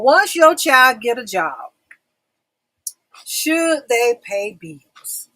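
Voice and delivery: loud, stretched-out title question; topic announcement. Real, not acted. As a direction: proclaim the question slowly and firmly.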